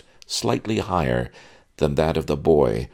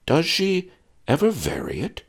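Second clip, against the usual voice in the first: put-on higher, curious old sage; scientific interest. Old sage asking the father about the swoon, curious. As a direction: ask like a detached scientist. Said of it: "with scientific interest".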